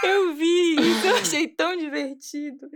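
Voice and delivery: high, slowed words through laughter; amused, laughing. Real, not acted. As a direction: say it while really laughing. Laughing at the campaign she saw. (falando rindo, achando graça)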